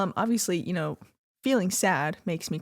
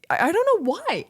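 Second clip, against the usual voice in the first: higher, louder baffled cry; exasperated outburst; 10 semitones higher, 5 dB louder than usual. Real, not acted. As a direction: throw up your hands, higher. Exasperated at own shame.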